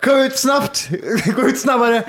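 high, laughing shout; mock shout. Friend hamming up a shouting shop owner. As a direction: bark it like a hyped seller.

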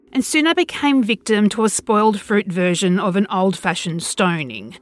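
ordinary delivery, nothing marked

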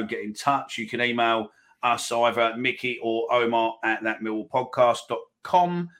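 ordinary talk

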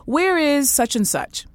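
high, slow demanding boss question; mock imperious boss asking; haughty boss voice. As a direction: ask it like an entitled boss. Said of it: "she's like".

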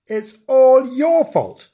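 high, loud, drawn-out accusation; indignant blame. Acted. Bernard blaming Frank for lost dinner, indignant. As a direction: throw the blame in a huff.

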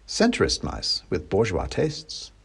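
ordinary delivery

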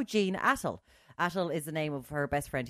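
usual speaking voice

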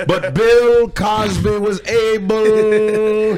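very slow, loud Cosby voice; Bill Cosby impression. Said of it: "He's like this".

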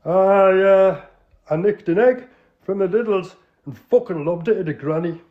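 put-on Geordie accent. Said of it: "an authentic, uh, Northerner".

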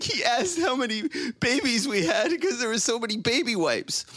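high-pitched mock upset wife; imitated laugh-cry. Wife on the phone relaying the plumber's question, imitated upset. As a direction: keep up her tearful, half-laughing complaint. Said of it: "the laugh turns into a cry".

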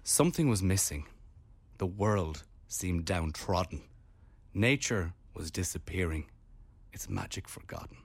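serious poem reading. Shown as a demonstration. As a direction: read it as a solemn voiceover. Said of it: "I try to be serious".

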